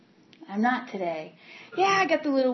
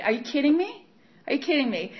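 disbelief; 6 semitones higher, faster than usual. Real, not acted. Outraged at sponsor's trick.

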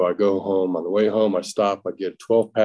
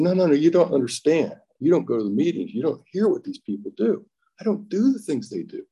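put-on higher, urgent drunken protest; desperate pleading; 8 semitones higher than usual. Drunk husband pleading with tearful wife, higher and urgent. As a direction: beg her to understand.

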